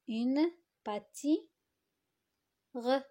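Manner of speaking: "Une pâti-, re" with a gap before re; incomplete word, segmented. Shown as a demonstration. Teacher shows pupils the incomplete noun, pausing at the gap. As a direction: leave a silent blank between "pâti" and "re". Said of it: "les compléter par les syllabes".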